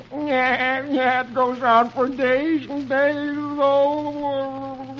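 high, drawn-out mock crying; Alexander. A narrator's reading. Alexander dreading days of cleaning, high tearful wail. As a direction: bawl slowly like a comic boy, ending in a wail. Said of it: "Alexander starts to cry".

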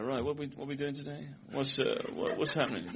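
soft, vague hippie-ish teacher; laid-back teacher. Retold or imitated. Cool teacher sauntering in late to class, quiet and laid-back. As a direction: amble through it quietly and absently. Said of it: "he eventually sauntered in".

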